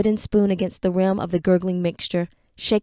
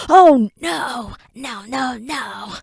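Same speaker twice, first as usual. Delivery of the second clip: fading cry; 3 semitones higher, much slower than usual. Acted.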